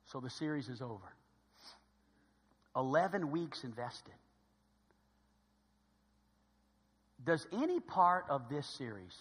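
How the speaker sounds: low, soft, with long pauses; solemn quiet appeal. Real, not acted. Preacher asking congregation to examine themselves, solemn quiet appeal. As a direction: let each question settle gently.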